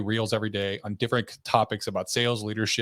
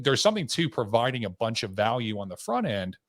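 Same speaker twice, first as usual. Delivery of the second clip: usual speaking voice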